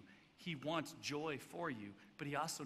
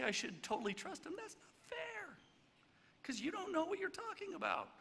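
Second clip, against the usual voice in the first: high-pitched, whiny objection; mock protest; 13 semitones higher than usual. Preacher mimicking someone refusing to trust, high mock protest. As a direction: climb way up into a squeaky mock complaint.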